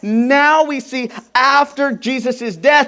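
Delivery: normal talking voice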